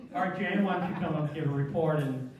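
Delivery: normal talking voice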